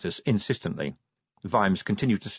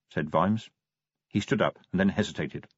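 ordinary delivery

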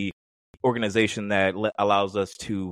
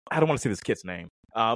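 ordinary delivery

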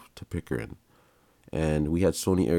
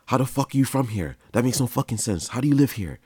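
yelled in disbelief, much higher; an angry cop shouting at Beeks; screaming cop; 6 semitones higher than usual. Retold or imitated. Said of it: "guy screaming at him".

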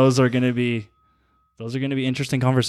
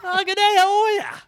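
very high, laughing Aussie greeting; Australian accent. Shown as a demonstration. Second go at the requested greeting, high giggly Australian accent. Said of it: "in an Australian accent".

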